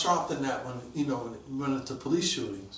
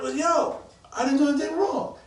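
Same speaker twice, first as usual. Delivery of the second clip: high, raised-voice self-defence; indignant protest; 10 semitones higher, 3 dB louder than usual. Retold or imitated. Accused officer defending himself to his bosses, indignant protest. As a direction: plead innocence, pitched well up.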